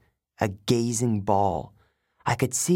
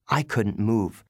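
ordinary delivery, nothing marked

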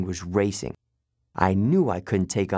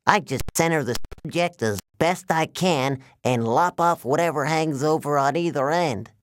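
higher-pitched, cheerfully businesslike giant; giant waterbed salesman villain; 6 semitones higher than usual. Acted. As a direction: explain the grisly fix like a sales routine.

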